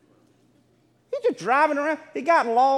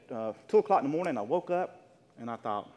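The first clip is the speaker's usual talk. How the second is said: ordinary delivery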